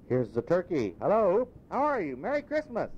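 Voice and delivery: put-on an old miser gone giddy as a baby, rising to a high cry on Hello; giddy old Scrooge. Scrooge waking changed, giddy with delight.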